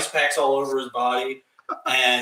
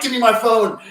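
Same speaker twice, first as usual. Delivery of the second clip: high, loud panicked order; frantic demand; 9 semitones higher, 8 dB louder than usual. Retold or imitated. Yelling at Pseudo for the phone, panicked. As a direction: bark it out in a panic.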